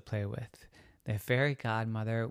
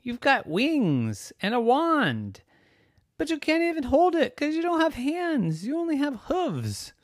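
put-on very high, exasperated kid voice; high-pitched childish scorn; incredulous girl; 5 dB louder than usual. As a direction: point out the absurdity in a high voice. Girl mocks cow's wand.

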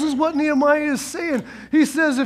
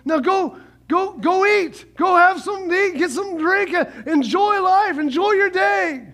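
cheerful send-off; 5 semitones higher than usual. Retold or imitated. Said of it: "And then he goes".

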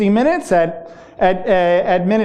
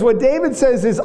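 ordinary talk

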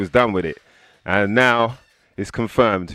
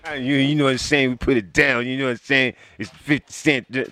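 light [name] impression, a bit higher; 4 semitones higher than usual. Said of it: "That's my best impression".

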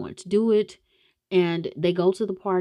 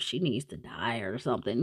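tossed-off, much softer; quiet flippant mutter; flippant aside; 8 semitones lower, 9 dB quieter than usual. Retold or imitated. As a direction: throw it away, half-joking. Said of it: "she says it flippantly".

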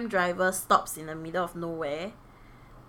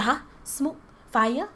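surprised; 8 semitones higher, 6 dB louder than usual. Retold or imitated. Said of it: "I was like".

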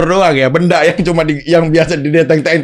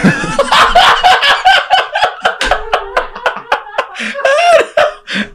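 a long high burst of laughter. Real, not acted. Laughing hard at his friend's joke about waiting for his downfall.

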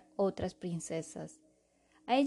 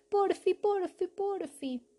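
high wheedling pleading; pleading child voice; 11 semitones higher, slower than usual. Acted. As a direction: coax like a begging little girl. Princess begs her father.

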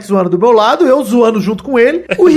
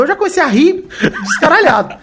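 ordinary talk, nothing marked

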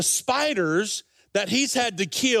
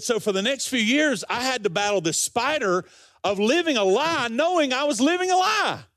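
building from raised pitch to a high cry; impassioned testimony; 5 semitones higher than usual. Retold or imitated. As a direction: climb in pitch to an anguished climax. Quoted testimony builds to a climax.

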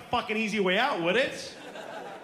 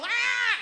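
put-on kid screech; 12 semitones higher, 4 dB louder than usual. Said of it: "And so they yell".

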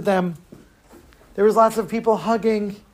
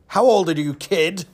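put-on lightly accented big-man voice; put-on heavy stranger; huge gruff man. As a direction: question the kid as a giant. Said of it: "three hundred pound, seven-foot man".

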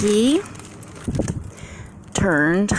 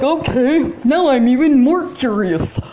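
"Okay, now I'm even more curious" in a high, put-on teasing voice; mocking curious taunter; 8 semitones higher, 4 dB louder than usual. Mimicking people who mess with her, teasing and high. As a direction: play the people who won't listen, higher and nosy. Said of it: "They're like".

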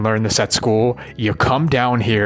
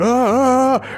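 a hammed-up flustered ah ah ah; a mock panicked yelp when starting out; 13 semitones higher, slower than usual.

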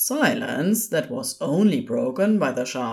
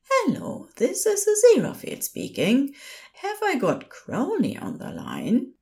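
much higher, proper helpline voice; high-pitched, courteous nurse; polite nurse; 10 semitones higher than usual. A narrator's reading. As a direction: reassure the caller politely in a high voice.

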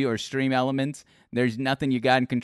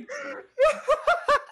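loud high laugh; 3 dB louder than usual. Real, not acted. Host cracking up at his guest's Kermit bit.

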